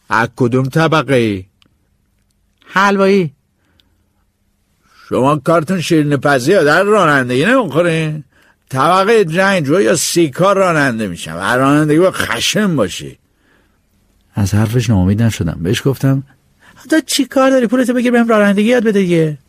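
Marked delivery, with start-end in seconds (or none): 0.1-13.2 a hint of a scornful old driver, a bit higher; lightly voiced gruff driver. Driver questions the narrator. 16.9-19.4 put-on much higher, pushy young voice; boy brushing off the driver's doubts; cheeky boy. Boy answers driver back.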